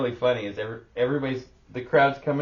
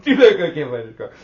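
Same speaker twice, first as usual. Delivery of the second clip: mocking onlooker; 5 semitones higher, 13 dB louder than usual. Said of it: "everybody's like".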